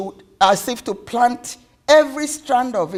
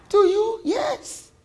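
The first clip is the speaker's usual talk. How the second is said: high-pitched, heartfelt answer; emotional reassurance; 12 semitones higher, slower than usual. Acted. Mother tenderly reassures child.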